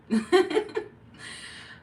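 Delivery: loud, high burst of laughter; laughing. Real, not acted. Amused by acting out the flirting, loud high laugh. Laughing at acted self-talk.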